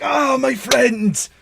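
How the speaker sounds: high, drawn-out hearty shout; overcome, booming character voice; booming delighted greeting. Acted. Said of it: "He's like".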